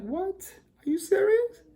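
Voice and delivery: high, rising, astonished question; can't believe the good news; stunned disbelief. Retold or imitated. Cousin says it's free.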